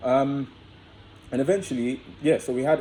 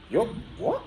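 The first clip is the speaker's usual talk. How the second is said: shocked disbelief; 11 semitones higher than usual. Retold or imitated. Shocked at her keeping condom.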